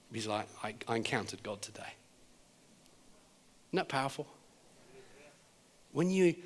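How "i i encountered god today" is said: very soft, low imitation of awed gratitude; understated, humbled quiet; moved, retold. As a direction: say it hushed, barely projected. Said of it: "he is starting to weep".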